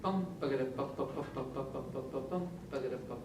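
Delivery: pa-pa rhythm sung softly like a strum; sung redoble rhythm. Shown as a demonstration. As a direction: chant the redoble rhythm in syllables. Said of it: "the hardest one is El redoble".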